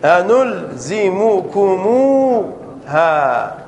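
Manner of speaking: أنلزمكموها spelled out slowly, each syllable stretched. Shown as a demonstration. Teacher to students, drawing out أنلزمكموها slowly syllable by syllable. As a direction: drag out أنلزمكموها piece by piece. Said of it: "زيد مثال آخر".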